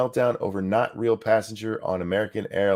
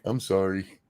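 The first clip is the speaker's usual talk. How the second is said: mock Canadian apology. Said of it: "They'll be like".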